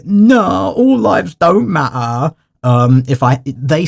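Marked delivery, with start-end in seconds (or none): 0.0-2.3 light imitation of an East London accent, slow. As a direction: mildly echo the clip speaker's East London accent. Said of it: "going by the accent of the person saying".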